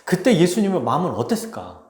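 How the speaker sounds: normal talking voice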